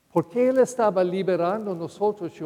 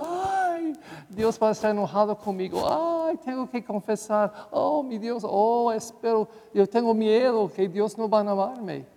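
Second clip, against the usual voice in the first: mock anxious, pitched up high; hammed-up fretting about God's anger; fretful sinner; 3 semitones higher than usual. Priest mimicking Catholics who fear God after sinning, mock anxious. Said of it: "Pero dicen".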